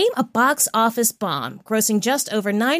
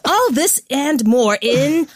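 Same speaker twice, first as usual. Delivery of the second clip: slow build dropping to a breathy whisper; hammed-up movie trailer sign-off, title whispered; trailer narrator; 3 semitones higher, much slower than usual. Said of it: "trailer narration gigs".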